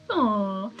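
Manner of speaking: ordinary delivery, nothing marked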